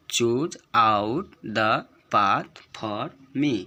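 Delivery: "Choose out the path for me" very slow, word by word; rhythmic verse reading. Shown as a demonstration. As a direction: chant out each word of "Choose out the path for me" separately, drawn out. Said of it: "rhyme की तरह पढ़ेंगे इसको पहले". ("Choose out the path for me" को लय में, एक-एक शब्द खींचकर)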